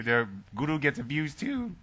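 "their guru gets abused too" with a cracking, emotional voice; choked up, half joking. Real, not acted. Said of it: "my voice is cracking 'cause I'm getting emotional".

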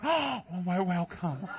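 breathless mock welcome. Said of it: "They're like".

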